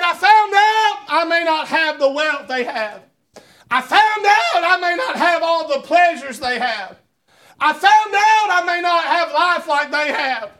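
preacher crying out the promise; preaching at full force. Acted. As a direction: swell it up for the congregation.

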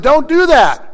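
high-pitched call to stop; urgent retold command. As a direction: act out stopping someone in a hurry. Acted urgent stop to jailer.

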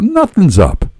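high, hasty denial; blurted out. A narrator's reading. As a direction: blurt it defensively. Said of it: "I blurted out".

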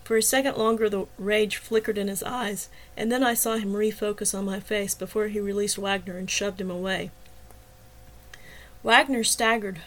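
ordinary delivery, nothing marked

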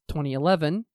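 usual speaking voice

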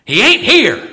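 loud, high East Tennessee drawl; Don's East Tennessee drawl. Retold or imitated. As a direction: boom it from the pulpit in a Southern preacher's East Tennessee drawl. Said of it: "in his wonderful East Tennessee drawl".

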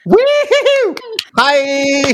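very high whooping cheer into a bright hi; excited welcome cheer. Real, not acted. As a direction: whoop the guests onto the show. Cheering in the guests.

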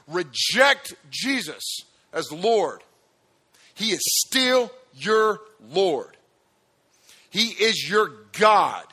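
loud, ringing preacher voice building to a shout; full-force preaching. Acted. Pastor pressing the crowd about rejecting Jesus, preaching hard. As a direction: drive it home like a revival preacher.

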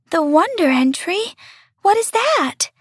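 put-on high, wondering child voice; curious little star. A narrator's reading. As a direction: ask with rising wonder. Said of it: "asked the star".

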